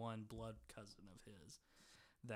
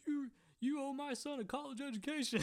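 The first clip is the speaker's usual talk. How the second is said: much higher, louder laughing mock rant at the quarterback; angry dad; 7 dB louder than usual. Retelling Hank scolding the old quarterback, laughing mock yell. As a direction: yell it like a furious dad, cracking up. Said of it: "'Cause he's like".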